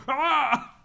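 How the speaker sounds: loud high strained yell; imitated strained scream. Streamer imitating Equius straining, high strained yell. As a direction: mimic his clenched internal scream. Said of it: "he goes".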